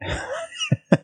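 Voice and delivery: rising high giggle. Real, not acted. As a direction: burst of amused giggling. Giggle at his own idea.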